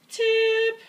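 high, loud urgent calls; firemen calling out. Acted. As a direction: shout a warning to sit down. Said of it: "the firemen are saying".

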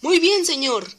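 high, loud eager yes sir; eager reply. Acted. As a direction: answer the boss brightly. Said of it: "dijo Thomas".

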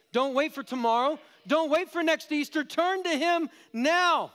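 high, loud urgent appeal, slowing at the end; preaching at full force. Acted. Preacher urging the congregation to turn now, full force. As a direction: drive the appeal home.

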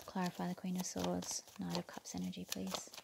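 hushed whisper to the cards; whispered request. Real, not acted. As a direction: whisper it as if to yourself.

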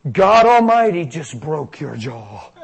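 loud, slow proclamation; emphatic announcement. Retold or imitated. Minister telling the girl of her miracle, emphatic announcement. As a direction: proclaim it with drawn-out force.

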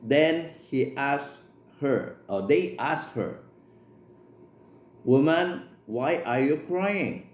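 slow, measured Bible reading; scripture reading. Acted. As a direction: read the verse slowly and solemnly. Reads scripture aloud in English.

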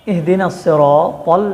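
slow "সিরাতাল" with thick, rounded "tol"; heavy emphatic to. Shown as a demonstration. Teacher preparing a correction, heavy t in "সিরাতাল". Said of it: "মোটা করে উচ্চারণ করতে হবে".

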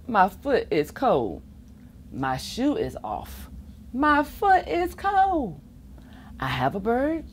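ordinary delivery, nothing marked

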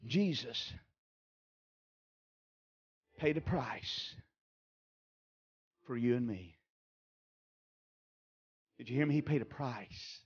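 low, soft, hushed voice. Real, not acted. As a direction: pull the room in close. Preacher drops to murmur.

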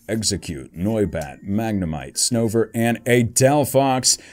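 ordinary delivery

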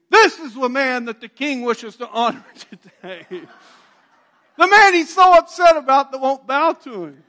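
a crier calling across the street; loud, high street crier; loud mocking proclamation. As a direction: shout it out to the crowd, high and loud. Mordecai paraded, proclamation shouted.

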